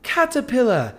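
put-on high, sing-song British kids' presenter; Steve and Maggie. Kids' video host teaching a word, high British accent. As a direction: imitate a British children's video host, bright high pitch. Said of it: "it was like a Steve and Maggie video on YouTube Kids".

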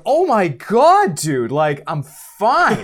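slow, high, loud exasperated protest; embarrassed teacher insisting to the cop he is fine; exasperated denial. Retold or imitated. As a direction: groan it out, embarrassed and fed up.

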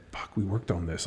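soft grumble kept inside; inner thought. Retold or imitated. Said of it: "like, inside, you're like".

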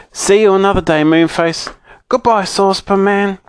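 high, lively storybook goodbye; calling farewell. A narrator's reading. Bessie taking leave of Moonface, calling goodbye.

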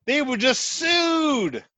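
high, slow emphatic shout. Real, not acted. Emphatic announcement.

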